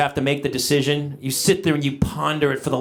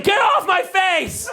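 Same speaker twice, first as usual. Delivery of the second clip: high, slow startled yell; startled shout; 14 semitones higher, slower than usual. Retold or imitated. Dad woken by a kid on his face, startled yell. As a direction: yelp like you've been woken roughly. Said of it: "he's like".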